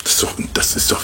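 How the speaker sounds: quick voiceless whisper to the audience; fast whisper. Real, not acted. Preacher leaning in to the congregation, fast whisper. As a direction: confide it fast and hushed. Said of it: "unvoiced fast aside".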